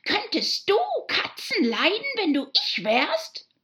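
indignant mouse, very high and loud; shrill, passionate shriek. A narrator's reading. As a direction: demand it shrilly, full of outrage. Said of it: "schrie die Maus mit schriller, leidenschaftlicher Stimme". (schrill empörte Mäusestimme)

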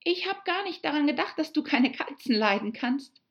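put-on high, quick girl voice excusing herself; apologetic Alice. Alice hastily apologising to offended mouse. (hastig entschuldigend, hohe Mädchenstimme)

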